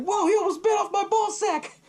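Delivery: very high-pitched startled yelp; mock alarm. Son jumps back from the gator, hammed-up alarm. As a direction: play a son jumping back in shock, voice shooting up.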